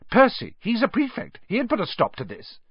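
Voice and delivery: put-on high, indignant girl's retort; snapped. A narrator's reading. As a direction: threaten to tell on them, pitched high. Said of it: "Hermione snapped".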